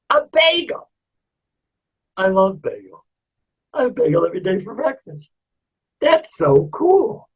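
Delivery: delighted amazement. Acted. Man amazed as a bagel appears, delighted amazement. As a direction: marvel at it.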